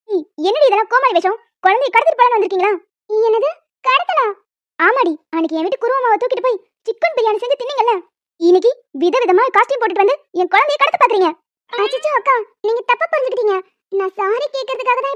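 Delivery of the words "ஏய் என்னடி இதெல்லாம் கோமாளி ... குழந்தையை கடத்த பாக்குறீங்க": loud, scolding mother's voice; angry mother. Acted. Mother scolding the costumed neighbour, angry. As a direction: tear into the intruder.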